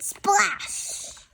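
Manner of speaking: high playful splash; splash sound. Acted. Child reads the watering sound, high.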